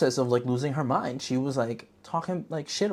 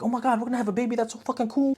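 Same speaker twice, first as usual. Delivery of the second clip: put-on much higher, giddy excited boyfriend; giddy high dude; 11 semitones higher, faster than usual. Boyfriend hearing about the baby, in a high giddy voice. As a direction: gush like a thrilled new dad. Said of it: "the guy's like".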